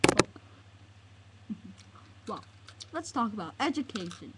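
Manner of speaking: ordinary talk, nothing marked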